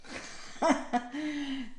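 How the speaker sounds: amused chuckle; laugh. Real, not acted. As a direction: laugh knowingly at the card. Laughs at card "retour d'un ex.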